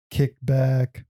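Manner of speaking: ordinary delivery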